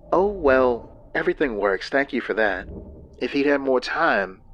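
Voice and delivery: a hint of a tired wife; lightly voiced, worn-out woman; weary woman. Lynn's voice in story.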